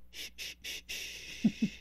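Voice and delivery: whispered shushing, very soft; soothing hush. Retold or imitated. Dad shushing his hurt little girl, soft whispered hush. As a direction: hush a crying child gently. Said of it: "you say something real profound and spiritual".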